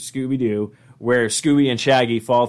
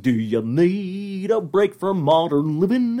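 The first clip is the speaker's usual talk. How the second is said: Goofy voice; 5 semitones higher, slower than usual. Pretend. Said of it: "his little part where he's just".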